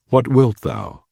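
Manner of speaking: plain reading voice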